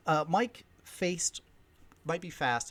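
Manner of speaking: usual speaking voice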